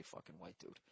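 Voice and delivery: hushed aside; whispered. Shown as a demonstration. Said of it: "I would just say".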